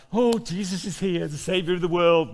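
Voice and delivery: high, loud, fussing hostess voice; flustered Martha. Retold or imitated. Preacher voicing Martha, excited and flustered. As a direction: play Martha all in a flap. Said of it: "And she's like".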